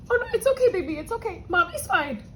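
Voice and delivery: high-pitched comforting talk to a pet; tearful soothing. Real, not acted. Owner calming her dog upset by crying, tearful soothing. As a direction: reassure the dog through tears. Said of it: "Cupcake doesn't like it when I cry".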